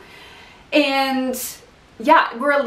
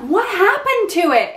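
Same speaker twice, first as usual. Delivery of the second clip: surprised; 10 semitones higher than usual. Retold or imitated. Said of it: "I'm like".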